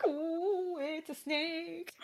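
soft mock whisper. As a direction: hush it like a secret. Whispered repeat of joke.

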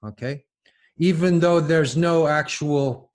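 normal talking voice

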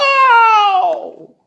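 long, loud high "ow" of pain; pained holler. A narrator's reading. Husband hit on his feet, hollering in pain. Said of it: "He hollered".

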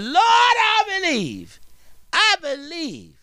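fervent cry. Acted. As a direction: cry out Thomas's confession in wonder. Thomas's awed confession, preached.

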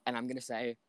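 usual speaking voice

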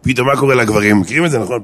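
ordinary talk

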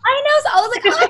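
bursting with the news; excited. Real, not acted. Excited retelling of the pick.

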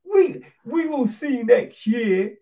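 high, loud confident vow; imagined defiant Hamilton. Pretend. Speaker as Hamilton vowing revenge next year, high and loud. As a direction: say it like a challenge to rivals. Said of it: "I'd be like".

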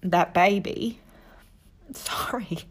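overcome, apology barely gets out; choked up. Real, not acted. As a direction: voice giving way into a whispered sorry. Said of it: "I'm getting a little bit emotional".

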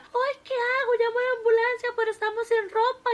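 high, loud panic; a wife frantic after her husband falls; panicked wife. A narrator's reading.

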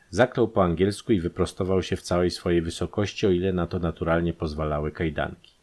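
ordinary delivery

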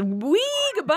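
high, gleeful sendoff; playful whee. Pretend. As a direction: sail away like a loose balloon. Balloon floating away joke.

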